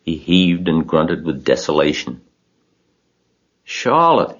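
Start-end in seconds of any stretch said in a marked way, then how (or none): 3.6-4.4 high, falling moan; sorrowful, high-pitched wail at moderate volume; anguished moan. A narrator's reading. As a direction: moan the name in grief. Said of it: "he moaned".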